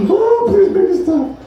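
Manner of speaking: high, loud, slow strained plea in agony; pained plea. Retold or imitated. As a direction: beg like your guts are on fire, dragging it out. Begging in toilet agony.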